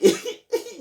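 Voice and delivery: short high giggle mid-story. Real, not acted. Laughs mid-joke.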